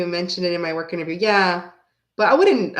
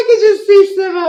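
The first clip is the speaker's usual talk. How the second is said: laughing; 11 semitones higher, 10 dB louder than usual. Real, not acted. Cracking up at image.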